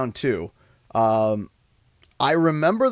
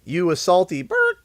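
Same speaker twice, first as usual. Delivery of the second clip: mock taunt; 3 semitones higher, slower than usual. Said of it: "people that used to be, say".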